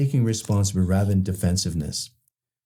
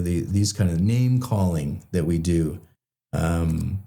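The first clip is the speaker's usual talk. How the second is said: ordinary talk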